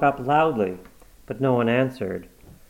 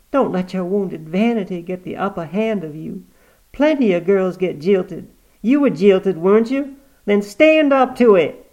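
a higher, louder, scolding inner voice; sharp voice; 8 semitones higher, 7 dB louder than usual. A narrator's reading. Inner voice scolding Granny for her vanity, sharp. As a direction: snap the words out like a stern conscience. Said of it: "said a sharp voice".

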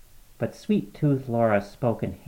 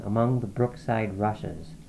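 usual speaking voice

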